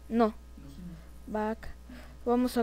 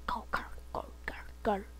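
soft, repeated urgent whisper; play-acted stealthy hiss; whispered calling; 11 dB quieter, slower than usual. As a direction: call your companion without being heard. Secretly calling companion.

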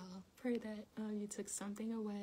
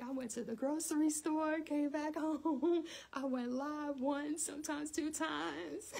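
high, loud, drawn-out boasting; hammed-up yelling; 6 semitones higher, 6 dB louder, slower than usual. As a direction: belt out the errands, wide awake and proud. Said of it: "Why are you yelling?".

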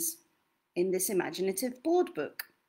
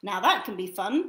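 gentle lively storybook read; reading to children; 4 semitones higher, 4 dB louder than usual. Acted. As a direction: read it like bedtime for a toddler. Reads second book aloud.

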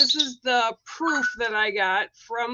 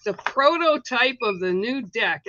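ordinary delivery

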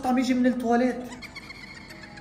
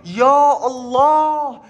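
drawn-out cry; 8 dB louder, much slower than usual. Acted. Sees the dangerous slime.

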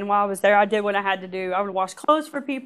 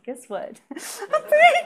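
laughing with joy; 4 dB louder, slower than usual. Retold or imitated. Walking out, telling the news.

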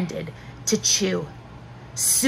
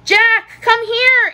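loud, high-pitched call; high, loud summons; 13 semitones higher, 5 dB louder, slower than usual. A narrator's reading. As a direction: call him over urgently, voice way up. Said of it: "Annie called".

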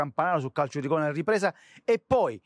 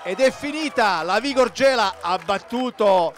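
higher and louder final call; excited full-time call; 6 semitones higher, 6 dB louder than usual. Acted. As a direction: announce the win triumphantly.

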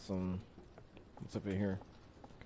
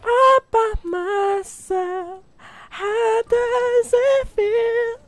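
creepy taunting voice; 4 dB louder, much slower than usual. Pretend. Taunting alone in maze.

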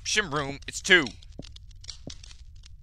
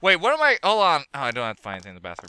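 ordinary talk

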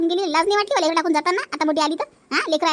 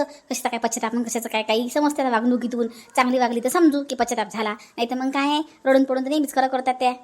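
a lower, dismissive woman's voice, scoffing at the apology; scornful neighbour; 6 semitones lower than usual. Acted. A lower woman scorning Shobha's repentance to the aunt, dismissive. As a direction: brush off her tears as an old trick.

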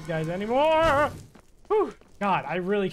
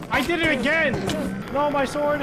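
high, loud cry of dismay; frustrated yell; 8 semitones higher, 4 dB louder, slower than usual. Real, not acted. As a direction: shout it out as everything goes wrong. Loses his sword.

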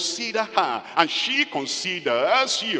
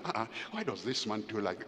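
annoyed muttering; 6 semitones lower, 10 dB quieter, faster than usual. Acted. Wife complaining to herself.

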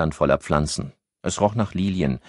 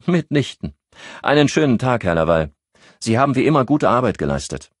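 BKA officer, higher brisk voice; 8 semitones higher, 5 dB louder than usual. A narrator's reading. BKA officer dismissing Henri.

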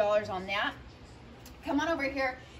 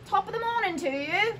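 light mock Irish accent, pitched higher; Irish greeting; 8 semitones higher than usual. Shop host greets buyers in a playful Irish accent. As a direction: chirp it like a stage Irishman. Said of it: "you have to speak like that".

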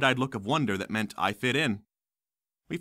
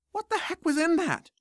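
high, shocked and grossed out; disgusted yelp. Acted. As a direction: squeal it in disgust. Boy after disgusting potion.

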